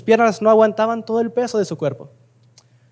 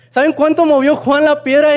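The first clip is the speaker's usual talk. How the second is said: emphatic repeat; 6 semitones higher, 4 dB louder than usual. Shown as a demonstration. Preacher presses the congregation, louder and higher. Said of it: "Pasa el año y ¿saben cuánto".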